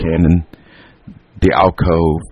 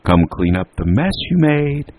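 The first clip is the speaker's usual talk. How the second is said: calling out; 11 semitones higher, slower than usual. A narrator's reading. Mother calls boys back to clean up, calling out. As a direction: summon them from upstairs in a raised call. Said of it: "Alice calling them again".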